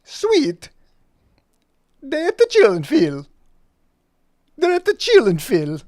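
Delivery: high, loud character voice; vampire-like dog voice. Acted. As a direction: announce it like a happy cartoon dog. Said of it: "sounding like a vampire".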